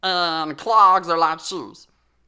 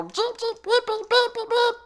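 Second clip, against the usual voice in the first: high squeaky beep beep; car horn beeps; 14 semitones higher than usual. Pretend. Sheep playing at driving, tooting the horn. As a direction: honk like a toy Jeep. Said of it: "drive around and go".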